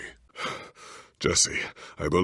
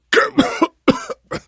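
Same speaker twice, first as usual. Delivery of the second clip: harsh, high coughing fit; hacking cough; 6 dB louder than usual. Acted. Sickly hero breaking into a hacking cough. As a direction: cough hard and rough.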